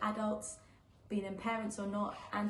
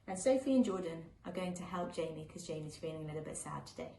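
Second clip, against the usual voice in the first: gentle, lilting picture-book read-aloud; reading to children; 4 semitones higher than usual. A narrator's reading. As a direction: read it like a bedtime story for little ones.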